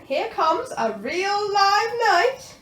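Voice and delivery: booming dragon teacher; Madam Dragon. A narrator's reading. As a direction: present the knight grandly. Madam Dragon announces knight.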